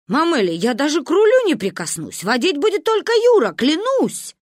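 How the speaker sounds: high, loud, hurried assurance; emphatic swearing. A narrator's reading. Uncle Misha promising not to drive, emphatic. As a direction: protest rising to a loud oath.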